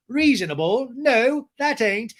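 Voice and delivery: high, loud mock disbelief; hammed-up incredulous retort; James. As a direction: imitate an outraged man objecting. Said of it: "And James is like".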